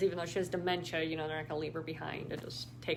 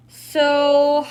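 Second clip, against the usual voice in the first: excited burst; 8 semitones higher, 16 dB louder than usual. Real, not acted. Loud burst ending the reading.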